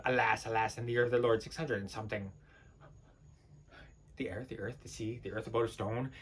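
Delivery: low, very soft incantation; bleak murmur. Acted. Intoning the great cold and dark, murmured low. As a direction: murmur it, sunk into yourself. Acted monologue, bleak hushed passage.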